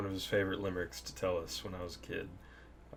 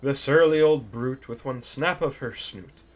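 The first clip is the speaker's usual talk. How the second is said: slow, louder sing-song limerick reading; limerick recitation; 4 semitones higher, 8 dB louder than usual. Acted. Reading his dad's favourite limerick to viewers, slow theatrical recital. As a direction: recite it with showman's relish, slowly.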